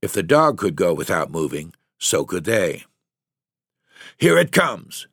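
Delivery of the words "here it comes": short and clipped, raised pitch; curt tense warning; terse. A narrator's reading. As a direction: bite off the words tersely. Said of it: "the fisherman said tersely".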